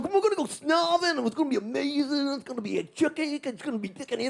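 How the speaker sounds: hammed-up keen pal; Des Bishop impression. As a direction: gush at a friend, trailing away. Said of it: "He was going".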